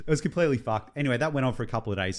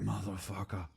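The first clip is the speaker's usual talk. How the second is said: muttered curse; 11 dB quieter than usual. Retold or imitated. Guzzes snap off in the nose.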